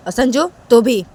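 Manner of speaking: loud, stern warning; stern mother. Acted. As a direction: lay down the law. Mother warns the children. (ज़ोरदार, सख़्त चेतावनी)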